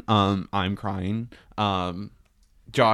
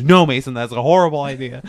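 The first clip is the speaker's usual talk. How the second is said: louder, sharp retold warning; a friend's louder warning, half-acted; mock scolding shout; 4 semitones higher, 9 dB louder, faster than usual. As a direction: tell her off quickly and loudly.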